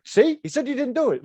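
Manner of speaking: high-pitched mock-angry stepdad; angry Ukrainian man. Stepdad grilling the bus driver, mock-angry high voice. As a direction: imitate an angry man crowing that he's proven right. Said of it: "he's, like, an angry Ukrainian man".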